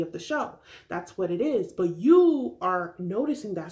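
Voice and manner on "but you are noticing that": ordinary talk, nothing marked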